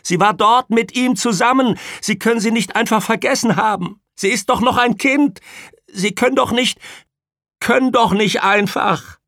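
frantic high screaming, breaking off unfinished. A narrator's reading. Mother pleads with the nurse for the child, screaming. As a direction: keep screaming at full height in protest. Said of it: "schrie sie".

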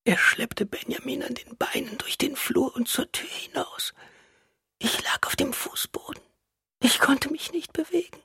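weak, frail whispering; despairing whisper. A narrator's reading. Wife relives lying helpless on the floor, despairing whisper. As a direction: whisper it helplessly, with little strength.

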